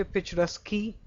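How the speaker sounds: "picturesque" ending in an extra "-kee"; mispronounced picturesque. Shown as a demonstration. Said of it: "correct pronunciation here?".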